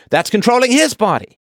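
sarcastic high echo of the women's line; mocking high-pitched quote. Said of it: "It's like".

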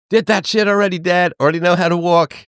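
high, exasperated son; mock son reply. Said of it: "It's like".

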